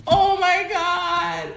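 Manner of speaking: stunned disbelief; awed drawl. Real, not acted. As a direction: draw it out in awe. Card reveal.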